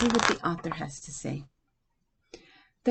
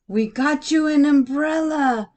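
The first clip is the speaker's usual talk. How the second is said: higher, louder eager announcement; yelled; 8 semitones higher, 7 dB louder than usual. Acted. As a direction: kids shout their gift proudly. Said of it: "Benito and Angel and Gloria yelled".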